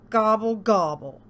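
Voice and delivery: loud grumbled gobble; grumbling turkey. A narrator's reading. Turkey rebuffed by cow, grumbling gobble. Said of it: "grumbled Turkey".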